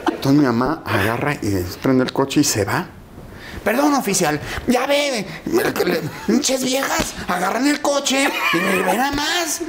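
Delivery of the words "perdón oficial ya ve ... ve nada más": disculpa cómplice imitada. Retold or imitated. Papá actúa ante los policías.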